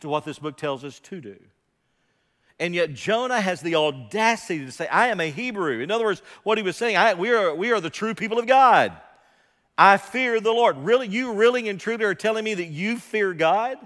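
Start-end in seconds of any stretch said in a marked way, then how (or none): 2.6-13.8 loud, high, driving sermon voice; fiery preaching. Real, not acted. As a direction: preach it hard, building to a challenge. Preaching at full force.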